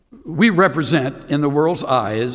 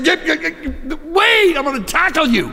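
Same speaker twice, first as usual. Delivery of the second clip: higher, urgent shout-ish call; frantic call; 11 semitones higher than usual. Retold or imitated. Buddy chasing Roy down the field, higher and frantic. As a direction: chase him down in a panic.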